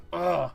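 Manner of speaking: loud, high swinging grunt; effortful grunt. Acted. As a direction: grunt hard into a big swing. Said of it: "he takes a big swing".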